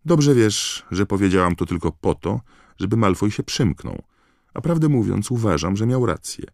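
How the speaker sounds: high-pitched emphatic Hermione; acted huffy retort; exasperated retort. A narrator's reading. Hermione retorts to mocking Ron.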